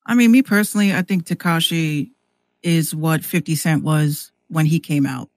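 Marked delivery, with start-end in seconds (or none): none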